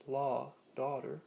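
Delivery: North American "law, daughter" with open aw and sounded final r; North American accent. Shown as a demonstration. Teacher giving North American example words, American open aw in "law, daughter". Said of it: "in North American style accents".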